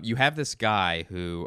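ordinary talk

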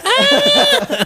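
very high, loud joke shriek; mock scream. Listener teasing how the victim looked outside, mock scream. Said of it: "Y por fuera".

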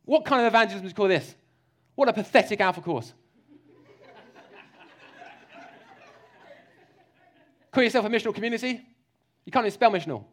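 mock the recruits with scornful barking; harsh general. Preacher parodies a harsh leader mocking the congregation, loud. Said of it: "He's not some general in an army".

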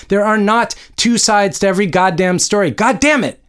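high, exasperated tirade; narrator's contained angry scream. A narrator's reading. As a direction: rail in furious contradiction, peaking on the curse. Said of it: "screamed Joan".

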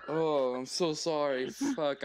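ordinary talk, nothing marked

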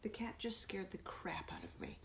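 hushed, startled whisper. Real, not acted. To her co-host after the cat spooked her, whispered.

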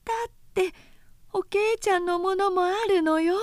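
high, tearful wail easing off; crying plea. A narrator's reading. Oyoshi pleads with Ohiro to keep the things, crying. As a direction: whimper out the excuse.

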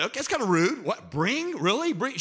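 hammed-up offended disbelief; mock affront. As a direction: play a put-out listener questioning the wording. Acts out an offended listener.

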